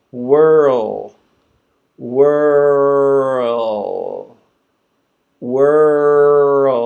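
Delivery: Whirl held long, tongue rising to L. Shown as a demonstration. Teacher building world step by step, drawn out. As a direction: stretch Whirl into the L. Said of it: "then we go to the L sound".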